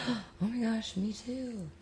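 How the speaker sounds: high, hushed mock squeal; mock excited fan. Fan meeting another Game of Thrones lover, mock excitement. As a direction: gush softly, high-pitched and hammed-up. Acted fan finding fellow fan.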